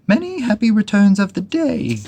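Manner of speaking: high, loud cheerful call. Acted. Pooh calls birthday wishes to Eeyore across the river, high and loud. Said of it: "called out Pooh".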